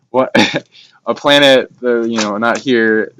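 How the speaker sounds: giggling while trying to go on; laughing. Real, not acted. Guest laughs while explaining off-world planet, laughing.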